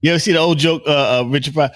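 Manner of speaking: usual speaking voice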